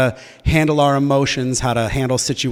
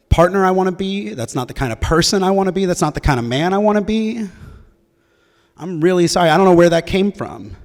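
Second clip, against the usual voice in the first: higher-pitched, earnest acted remorse; pleading apology; 5 semitones higher than usual. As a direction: plead for forgiveness, pitch raised.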